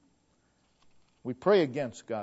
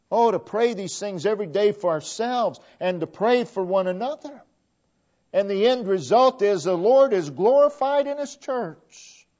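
louder, higher fiery preaching; preaching at full force; 6 semitones higher, 6 dB louder than usual. Acted. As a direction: lift the congregation with rising fervor. Preaching builds to a climax.

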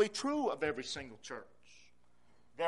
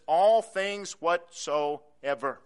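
"all things whatsoever" very slow and much louder; added emphasis; 10 dB louder, much slower than usual. Shown as a demonstration. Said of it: "He added emphasis".

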